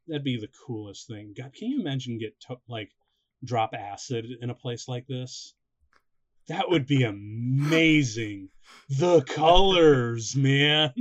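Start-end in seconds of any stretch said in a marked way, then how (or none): 6.5-10.9 slow, loud, drawn-out wonder; awed. Retold or imitated. Stoner friend marvelling at the colours, loud and slow. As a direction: marvel at the colours, stretching them out.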